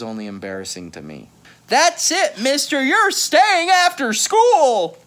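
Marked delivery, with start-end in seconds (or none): 1.7-5.0 high, loud, slow scolding; stern teacher. Acted. Teacher sentencing David to detention, loud high scolding. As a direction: lay down the punishment word by word.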